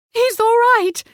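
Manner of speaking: very high, loud cry of relief; relieved cry. Acted. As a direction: burst out in joy. Woman's relief after shooting.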